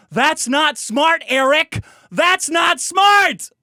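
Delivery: high, slow mocking shout; drawn-out, scolding yell; loud scolding. Mock scolding shout at Eric.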